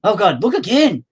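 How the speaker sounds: exasperated parent. Retold or imitated. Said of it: "I'm just like".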